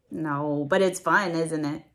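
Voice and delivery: usual speaking voice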